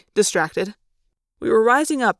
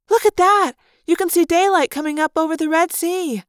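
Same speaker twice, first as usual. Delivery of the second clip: much higher, louder wonder; awestruck excitement; 9 semitones higher, 4 dB louder than usual. Acted. Cassie seeing Earth from orbit, much higher with wonder. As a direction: gasp it out in amazement.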